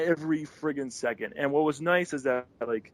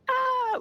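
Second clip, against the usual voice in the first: very high imitated squeal; fangirl squeal. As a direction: squeal like an excited fan. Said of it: "fangirl stuff".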